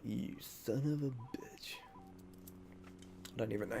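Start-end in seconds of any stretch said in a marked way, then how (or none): 0.0-1.7 slow, hissed whisper at the game; frustrated whisper. Real, not acted. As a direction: curse the game under the breath, slowly. Dying to Yoda in game.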